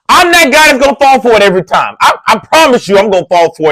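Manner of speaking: worked up about always falling for it; excited admission. Real, not acted. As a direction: blurt it out, all fired up. Exclaims he always falls for it.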